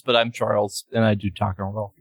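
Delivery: talking through a mouthful; chewing while talking; mouth full of peanut butter. Real, not acted. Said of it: "I have a mouthful of".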